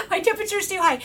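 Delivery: quick repeat through laughter; laughing. Shown as a demonstration. Repeating her own line to viewers, laughing. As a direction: laugh as you say it again. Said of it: "Wait, oh, my temperature's too high".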